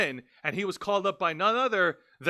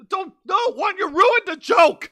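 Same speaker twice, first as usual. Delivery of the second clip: mock outrage; 11 semitones higher, 8 dB louder than usual. Real, not acted. Cohost spoiled the joke buildup.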